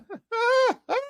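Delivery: squeaky laughing fit; high cackle tailing off; real high laugh. Real, not acted. Cracks up at own joke.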